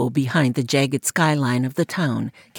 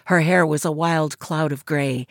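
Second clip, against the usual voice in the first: plain reading voice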